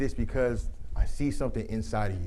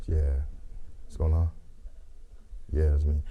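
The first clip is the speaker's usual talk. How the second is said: lower, put-on deep phone voice; 9 semitones lower, 3 dB quieter than usual. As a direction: drop it low like a crooner on the phone. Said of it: "couldn't wait to have a deep voice".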